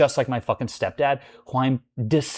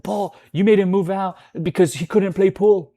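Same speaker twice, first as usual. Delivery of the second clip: landlord's accusation; 7 semitones higher, 5 dB louder than usual. Retold or imitated.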